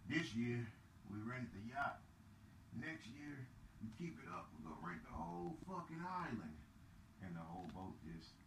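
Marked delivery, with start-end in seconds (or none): 0.0-6.6 put-on rich old man's boast. Rich old man bragging to his young crowd on the yacht. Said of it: "when he said".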